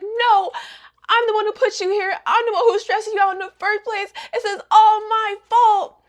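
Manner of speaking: high-pitched melodramatic self-blame; mock-distraught girl, insisting; distraught heroine. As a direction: protest in a high, hammed-up voice. Retold heroine blaming herself in hospital.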